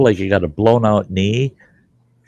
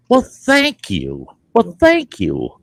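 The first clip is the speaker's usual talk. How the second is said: sarcastic thanks; 7 semitones higher, slower than usual. Retold or imitated.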